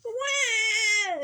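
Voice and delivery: put-on high piercing baby cry; baby ghost wail. A narrator's reading. Startled baby ghost wails at the noise. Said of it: "yelled Baby Ghost at the noise".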